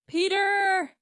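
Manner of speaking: long, high, loud call of a name; shouting. A narrator's reading. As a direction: holler for your son upstairs. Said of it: "his mother shouted again".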